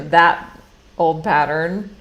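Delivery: ordinary talk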